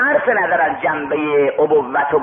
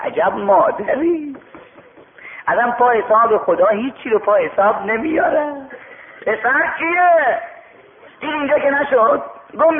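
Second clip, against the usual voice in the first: high preaching narration; 6 semitones higher than usual. A narrator's reading. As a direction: lift the tale into a high preacher's cry. (روایت منبری با صدای زیر و کشیده)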